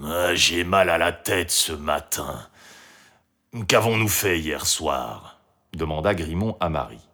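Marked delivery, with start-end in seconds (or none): 0.0-5.4 slow, low morning-after moan; grumpy. A narrator's reading. Grimont waking with a headache questions Marie, grumpy. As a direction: groan it out slowly, head aching. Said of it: "se réveilla de mauvaise humeur".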